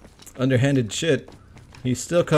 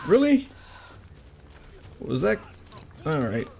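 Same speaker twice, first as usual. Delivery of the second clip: ordinary talk, nothing marked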